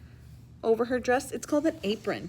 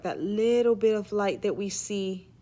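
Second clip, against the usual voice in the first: usual speaking voice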